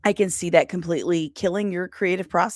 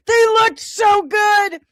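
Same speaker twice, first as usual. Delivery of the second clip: mock wail; 11 semitones higher, 7 dB louder, slower than usual. Real, not acted. Lamenting ruined eyebrows.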